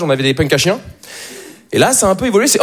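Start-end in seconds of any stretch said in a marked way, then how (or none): none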